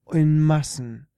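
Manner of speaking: emphatic. A narrator's reading. Emphatic repetition.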